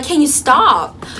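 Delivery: high, drawn-out irritated plea. Retold or imitated. Telling a giggling friend to quit, irritated. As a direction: plead in exasperation, stretching it out. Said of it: "she was irritating me".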